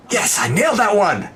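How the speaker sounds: high triumphant cheer. Real, not acted. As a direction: celebrate the joke. GM celebrates own joke.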